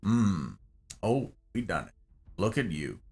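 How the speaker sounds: low, hushed narrator. Acted. Streamer joking about Jerry's real voice, as a narrator. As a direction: stay in the deep narrator voice. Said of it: "that's Jerry's real voice".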